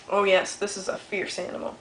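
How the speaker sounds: plain reading voice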